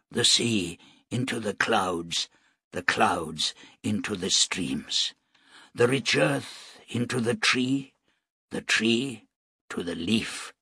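quiet, slow, calm recitation; soft reverent recital. A narrator's reading. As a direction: recite it gently like scripture. Nu recites to dying priest.

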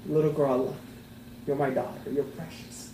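softening into a hushed, loving reassurance to a daughter; tender, as God. Pretend. God as a father assuring his little girl, softly tender.

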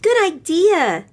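put-on high, bright little girl; little girl Lisa. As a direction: pop up high like an eager child. Lisa voiced by reader.

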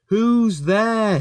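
high, drawn-out challenge; nervous shout. A narrator's reading. Rheneas calls to something in the dark tunnel, nervous shout. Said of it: "he shouted".

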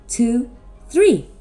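usual speaking voice

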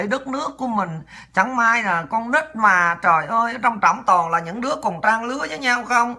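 soft, slow mock condolences; feigned sympathy. False comforters to a mother whose son died in service, feigned sympathy. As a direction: console softly and slowly, laying on the fake compassion. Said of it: "Cái bài đặt vô giả bộ nha".

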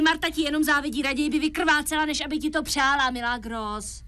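ordinary talk

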